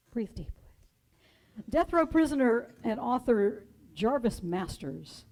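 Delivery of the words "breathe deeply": soft breathy whisper; hushed call to breathe deeply. Real, not acted. Preacher guides the congregation's breath.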